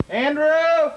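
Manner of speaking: shouting a name across the yard; calling out. Real, not acted. Calling for son outside.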